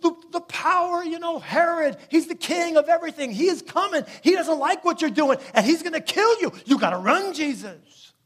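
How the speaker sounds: high, fast, panicked warning to Jesus; panicked disciples. Acted. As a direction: beg him to flee like terrified followers. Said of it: "they're freaking out".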